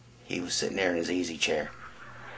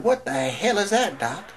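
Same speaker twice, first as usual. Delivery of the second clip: startled question; 11 semitones higher, 4 dB louder than usual. Acted. Patient sees the tapeworm.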